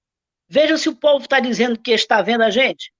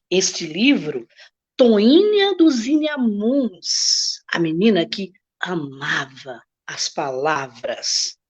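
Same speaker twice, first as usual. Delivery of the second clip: lento, pausado, cada sílaba com carinho; apresentação sedutora do livro; 4 semitones lower, slower than usual. Shown as a demonstration. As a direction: apresente o título como quem seduz. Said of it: "prepare um tom de voz expressivo". (leitura sedutora e expressiva do título)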